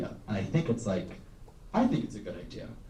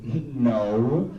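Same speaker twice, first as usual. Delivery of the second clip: curt offended no, louder; flat hard no; 5 dB louder than usual. Retold or imitated. Classmate snapping at the comedian, a blunt hard no. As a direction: shut it down with one cold word. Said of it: "wide-eyed glared at me".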